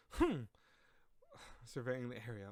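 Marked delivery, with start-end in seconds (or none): 0.1-0.4 high, loud "hmph"; demonstrated haughty huff; haughty harumph. Shown as a demonstration. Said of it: "that's why you go".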